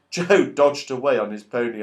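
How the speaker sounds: plain reading voice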